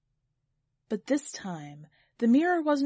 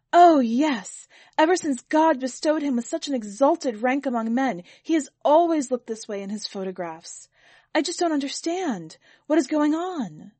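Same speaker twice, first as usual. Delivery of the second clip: higher, much louder, gushing; eager servant; 5 semitones higher, 6 dB louder than usual. Acted. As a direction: rush in to answer for him. Servant answers eagerly for Sir.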